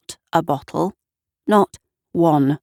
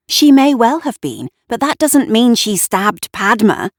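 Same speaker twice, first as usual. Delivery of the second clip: louder, higher heated retort; forgetting to keep it down; too loud; 5 semitones higher, 5 dB louder than usual. A narrator's reading. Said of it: "Bit loud, that".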